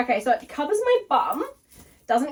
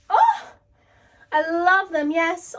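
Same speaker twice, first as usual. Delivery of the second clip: gushing; 6 semitones higher, 6 dB louder, slower than usual. Real, not acted. Gushing over leggings.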